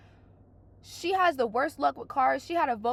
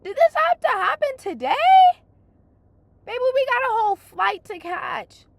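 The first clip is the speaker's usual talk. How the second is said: exasperated; 10 semitones higher, 8 dB louder than usual. Retold or imitated. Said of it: "I'm like".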